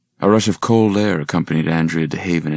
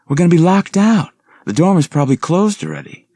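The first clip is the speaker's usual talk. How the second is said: high, loud urgent warning; 13 semitones higher, 7 dB louder than usual. Acted. Reba warning Suzanne about the dorm, high loud urgency. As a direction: hurry her along, worried.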